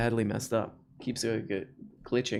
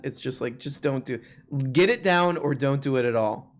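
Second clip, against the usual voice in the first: ordinary talk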